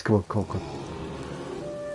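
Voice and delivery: slow low murmur willing it on; tense urging. Real, not acted. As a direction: softly urge the bear forward. Willing the bear to act.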